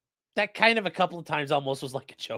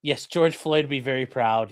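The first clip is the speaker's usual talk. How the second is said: ordinary delivery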